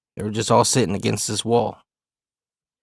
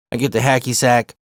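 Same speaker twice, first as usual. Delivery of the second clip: ordinary delivery, nothing marked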